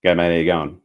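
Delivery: "G'day, mate. How you going?" with the consonants dropped; "G'day, mate. How you going?" run together, Australian style; all vowels. Shown as a demonstration. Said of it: "You just gotta leave out all the consonants".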